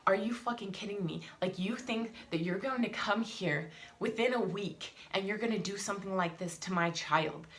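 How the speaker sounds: ordinary talk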